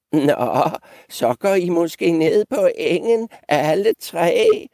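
put-on high, lilting old-goat tease; teasing. Old goat teasing the three goats, high and mocking. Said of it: "drillede den gamle gedebuk".

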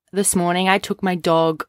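ordinary talk, nothing marked

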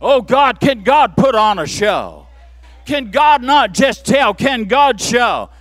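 loud, ringing shouted questions; preaching shout. Real, not acted. Preacher asking the congregation if God can show, shouting. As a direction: drive it home to the crowd. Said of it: "Oh, that was weak".

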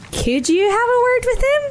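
high, pleading little rabbit voice. Acted. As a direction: beg the bear for help. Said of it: "said pleadingly".

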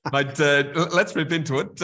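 fired-up host getting the guest going; laughing, eager launch. Real, not acted. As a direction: jump in with a laugh, keen to start.

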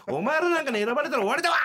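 high, loud mock fury at the judges; mock stage rant. Comic duo berating the judges onstage, loud mock rant. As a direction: bark it at the audience like a stage rant. Their angry stage routine acted out.

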